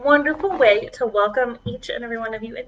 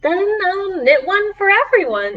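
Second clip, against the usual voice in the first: put-on higher bright little girl voice; cheerful girl; 8 semitones higher, 5 dB louder than usual. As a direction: offer it brightly like a kid with an idea. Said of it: "Annabelle said".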